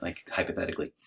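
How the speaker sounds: ordinary talk, nothing marked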